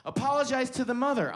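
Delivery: high-pitched mock scolding; stern command. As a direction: order it like an outraged director. Director scolding the actor, retold.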